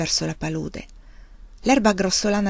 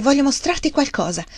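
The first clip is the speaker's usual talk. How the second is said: higher-pitched, eager excitement; a touch of excitement, pitched up; eager to show something, higher voice; excited; 7 semitones higher than usual. A narrator's reading. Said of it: "La sua voce tremava per l'eccitazione".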